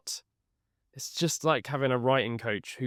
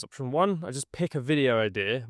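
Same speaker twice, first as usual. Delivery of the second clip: usual speaking voice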